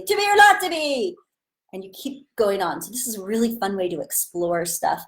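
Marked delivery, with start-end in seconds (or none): 0.0-1.2 very high squawked line; high, over-the-top exclamation; feeling outburst. Shown as a demonstration. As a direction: carry the silly yell into the line. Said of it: "And you say".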